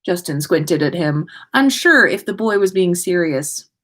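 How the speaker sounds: plain reading voice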